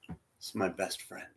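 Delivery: put-on soft, faint voice of a dying old friend; hushed retelling. Old man softly tells his brother about his friend, hushed. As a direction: speak it barely above a breath.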